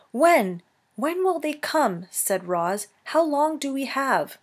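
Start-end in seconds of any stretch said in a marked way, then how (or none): 0.1-2.1 urgent questioning. Acted. Roz urgently questions dying robot. 3.1-4.4 urgent questioning. Acted. Roz urgently questions dying robot.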